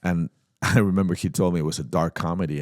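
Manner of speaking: normal talking voice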